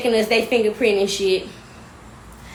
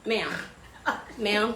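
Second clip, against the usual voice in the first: ordinary talk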